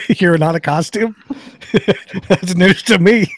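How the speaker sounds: teasing through laughter; laughing. Real, not acted.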